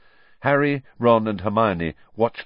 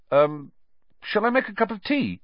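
awkward Ron; 13 semitones higher than usual. Acted. Ron's awkward offer.

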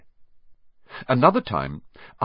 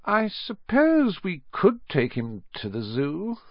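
slow, high, reluctant supposing; slowly; 11 semitones higher than usual. A narrator's reading. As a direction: draw it out slowly as you think. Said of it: "said Aunt Petunia slowly".

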